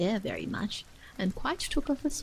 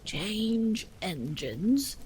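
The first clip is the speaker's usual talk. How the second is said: slow, hoarse and strained, breaking off; a horse forcing out two words; hoarse horse, very slow, cut short; much slower than usual. A narrator's reading. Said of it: "a hoarse voice spoke next".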